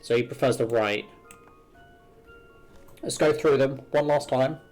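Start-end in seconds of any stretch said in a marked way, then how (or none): none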